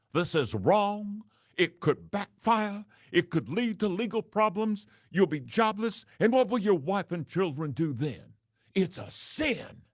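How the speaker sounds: a high, pious voice of moral warning; sanctimonious preacher. Retold or imitated. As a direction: thunder it down like a pulpit preacher warning of sin. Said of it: "First, the sanctimonious voice".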